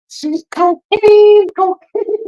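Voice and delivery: very high, drawn-out squeak; squeaky joke voice. Pretend. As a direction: stretch it out in a silly high voice. High put-on voice, joking.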